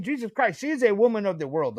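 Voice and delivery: normal talking voice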